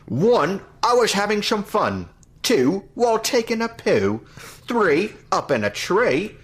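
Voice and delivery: hammed-up high Cockney counting rhyme. Host counting to a thousand for the show in mock Cockney. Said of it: "I'll try to do the, uh, the Cockney accent".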